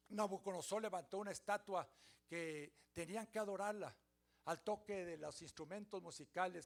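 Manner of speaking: ordinary talk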